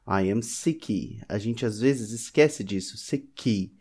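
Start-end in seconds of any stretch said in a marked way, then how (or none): 0.1-1.2 very slow "sick" with an extra ee vowel, like "sicky"; wrong Y ending. Shown as a demonstration. Teacher showing the common mistake, "sick" with an ee ending. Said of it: "pode sair como se tivesse um Y no final". 3.0-3.7 "sick" ending in an extra ee vowel, like "sicky"; wrong Y ending. Shown as a demonstration. Teacher imitating the student error, "sick" ending in ee. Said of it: "e pronuncia assim".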